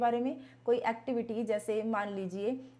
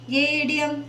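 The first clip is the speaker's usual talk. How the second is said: mocking rote style; 4 semitones higher, 10 dB louder, slower than usual. Shown as a demonstration. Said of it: "हम उसको ऐसे नहीं करेंगे".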